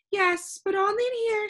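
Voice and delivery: high, gentle lady's voice; soft reply. A narrator's reading. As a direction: answer gently in a high, airy character voice. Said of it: "she replied softly".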